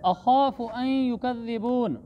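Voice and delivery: long aa in अख़ाफ़ु, then a held "ayy-yu" for अन; idgham merging. Shown as a demonstration. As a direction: recite अन युकज़्ज़िबून with n melted into y. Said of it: "नून को या में दाखिल कर दिया है".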